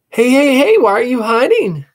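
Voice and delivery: put-on high, loud girl calling; bouncy teasing sister; little sister calling out; cheeky little sister. Sister runs up.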